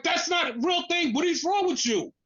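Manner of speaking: high-pitched, indignant telling-off; indignant outburst; exasperated scolding. Acted. Scolding those inventing complaints.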